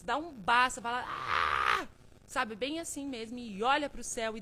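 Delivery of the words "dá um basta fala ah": grito de basta. Shown as a demonstration. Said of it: "fala, 'Ah!'".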